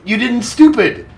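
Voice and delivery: high, quick squeal of delight; giddy high-pitched cheer; excited squeal. Real, not acted. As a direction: blurt it out thrilled and squeaky. Cheering on his game unit.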